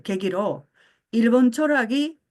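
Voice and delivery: usual speaking voice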